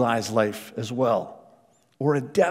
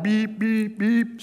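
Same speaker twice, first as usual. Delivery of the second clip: high, short car-horn beeps; imitated car horn; 7 semitones higher, 3 dB quieter, slower than usual. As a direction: mimic someone honking in repeated bursts. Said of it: "I hear a car horn".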